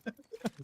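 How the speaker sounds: quick high-pitched laugh. Real, not acted. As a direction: laugh at yourself. Laughs at own accent.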